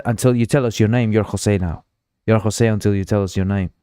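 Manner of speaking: ordinary delivery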